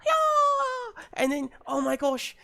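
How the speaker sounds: put-on very high, excited British kid voice; British child. Little sister raving to Skipper, high and breathless British child. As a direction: squeal it like a thrilled little British girl. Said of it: "I gotta do a child British".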